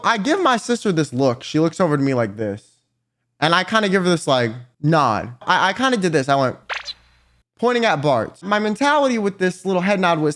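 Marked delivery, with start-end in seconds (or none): none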